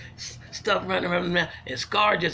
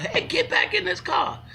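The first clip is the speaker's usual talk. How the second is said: high-pitched mock scream; retold scream; 13 semitones higher than usual. Calling people back to the car, retold scream. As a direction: scream it out, much higher than usual. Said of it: "I had to scream out".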